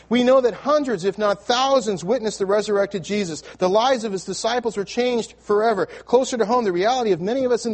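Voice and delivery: swelling pulpit proclamation; preaching. Acted. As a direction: lift the room with conviction. Preacher builds to a testimony.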